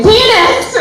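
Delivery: high-pitched mock scream; retold scream. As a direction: squeal it out as a kid's retold scream.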